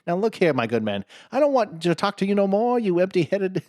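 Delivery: high, loud mock French accent; French taunter. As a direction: scold in a silly French accent. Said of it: "He's like".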